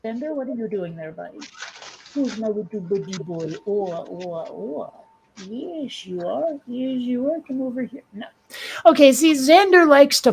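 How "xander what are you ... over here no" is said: pet talk. Real, not acted. To Xander the cat, coaxing him over in soft cooing baby talk.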